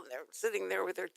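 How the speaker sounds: usual speaking voice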